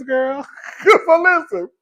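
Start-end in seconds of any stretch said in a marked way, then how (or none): 0.5-1.0 short, high squealing laugh. Real, not acted. Laughing burst.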